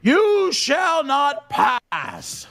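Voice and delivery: slow, high booming wizard shout; Gandalf. Retold or imitated. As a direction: bellow it like a wizard at a bridge. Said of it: "Looking like Gandalf the Grey".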